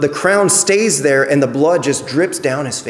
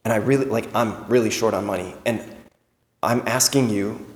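lower, softer, a bit sheepish asking a favour; lightly voiced loved one making an awkward request; hushed mock-innocent request to a family member; sheepish plea; 5 semitones lower, 6 dB quieter than usual.

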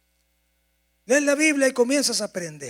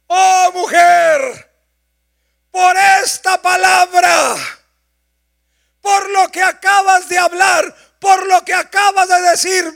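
loud, high, slow proclamation; a slow, booming blessing; fervent declaration; 11 semitones higher, 14 dB louder than usual. Acted. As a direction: proclaim it slowly and grandly. Jesus voiced praising woman.

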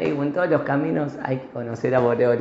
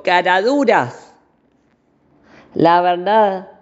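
a louder, slow mock yell; king yelling; 4 semitones higher, 5 dB louder, slower than usual. The king shouts at his ministers again, mock anger. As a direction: bark the insult at the ministers, playfully angry. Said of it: "les gritó el rey".

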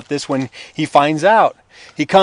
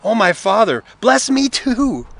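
tearful pleading; 6 semitones higher than usual. A narrator's reading. Esau pleading with his father, understated tearful begging. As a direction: beg as if close to breaking down. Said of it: "Esau broke down and he wept".

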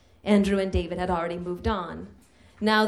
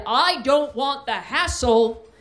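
stage shout; 5 semitones higher, 4 dB louder, slower than usual. Retold or imitated. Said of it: "stage shouted".

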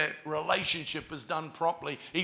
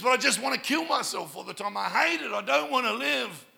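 quick, higher-pitched voicing of her despair; retold anguished outburst; 5 semitones higher than usual. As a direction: imitate a desperate girl's outpouring. Voicing a suicidal girl's despair.

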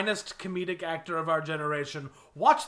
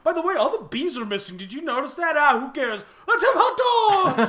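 cheerful hot dog enthusiast, getting louder; beaming, carefree, building to a shout; jolly loud grill dad; 3 semitones higher, 4 dB louder than usual. Acted. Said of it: "they're just like".

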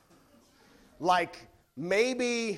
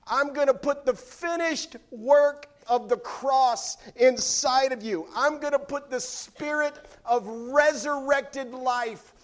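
loud, rising preacher's promise; preaching; 6 semitones higher, 7 dB louder than usual. Acted. Preacher proclaiming Jesus' promise to the church, loud and rising. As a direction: build it to the rafters.

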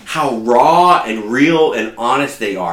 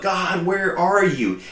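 quiet plea; 5 semitones higher, 6 dB quieter, slower than usual. Retold or imitated. Voicing a sufferer's plea.

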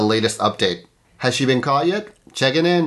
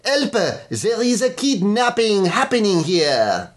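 put-on French accent; 11 semitones higher, slower than usual. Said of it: "you could do it in an accent".

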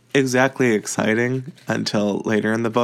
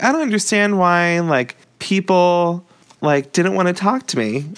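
put-on whiny girl quote; 7 semitones higher than usual. Girl's whiny quote in retelling.

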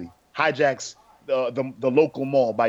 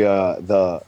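ordinary talk

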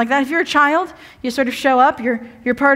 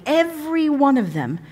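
ordinary delivery

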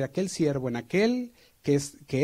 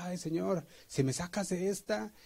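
high, pleading and rushed; bargaining with God while hanging on; the boy on the tree pleads with God in a high frantic voice; desperate prayer; 6 semitones higher than usual. Retold or imitated. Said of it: "empezó a orar y decía".

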